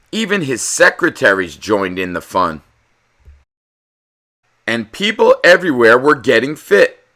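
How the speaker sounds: animated storybook narrator; story narration. A narrator's reading. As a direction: read it like a bedtime picture book. Reads picture book aloud.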